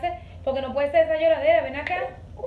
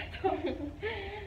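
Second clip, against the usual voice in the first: laugh; 6 semitones higher, 10 dB quieter than usual. Real, not acted. Toddler bumps her.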